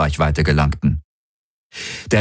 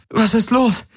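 much higher, sudden frightened cry; startled call. A narrator's reading. Kirai wakes to the alarm and calls Hawks, frightened. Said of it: "rief sie erschrocken".

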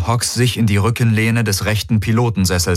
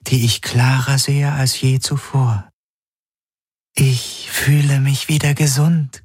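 fading dream figure; 6 semitones higher than usual. A narrator's reading. The dream figure tells Garland she is fading, gently sad. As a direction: sound fragile, glad yet doomed. Figure's voice held over many lines.

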